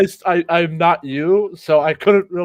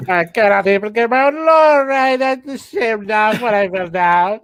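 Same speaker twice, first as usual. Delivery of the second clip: higher, put-on mimic voice; mocking impression; 7 semitones higher than usual. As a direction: parrot it back in a silly high voice. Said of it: "I tried to impersonate you".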